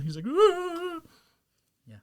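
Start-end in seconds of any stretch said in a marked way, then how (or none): 0.3-1.0 very high cartoon scream of flight; imitation high-pitched yelp; panicked mock yelp. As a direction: squeal it as if running for your life. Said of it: "run from his life from Vegeta".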